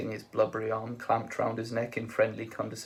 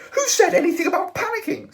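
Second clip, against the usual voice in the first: high, loud indignant snap; snapped; 11 dB louder than usual. A narrator's reading. Arthur snaps at Ford, a high, loud snap. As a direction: bite back at Ford, offended. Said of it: "snapped Arthur".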